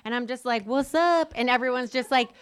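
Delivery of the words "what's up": loud, higher, brash greeting; drunken party greeting. Retold or imitated. Boasting about herself out drinking, brash loud greeting. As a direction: call it out cockily, pitched up.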